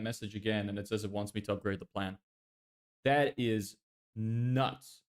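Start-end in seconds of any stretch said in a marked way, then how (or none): 3.0-5.0 very slow, stretched; slow, flat amazement; drawn-out disbelief. Real, not acted. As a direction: drag every word out in disbelief. Complaining about the paywall.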